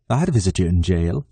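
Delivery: low playful teasing. Acted. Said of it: "he teases".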